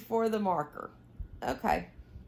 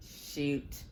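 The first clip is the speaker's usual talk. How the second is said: soft, low frustrated word; a quiet mild oath; muttered curse; 6 semitones lower, 9 dB quieter than usual. Real, not acted. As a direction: mutter it under your breath. Lost her place in pattern.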